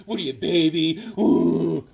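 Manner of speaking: jeer, then imitate a bawling baby; mock crying taunt. Mocking Son of Satan for crying, taunting fake wail. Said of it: "he's crying like a little".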